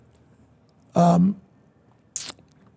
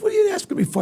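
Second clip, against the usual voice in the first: baffled protest; much faster than usual. Retold or imitated. Singer to the President who wants his vote, baffled. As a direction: pitch leaping high in disbelief.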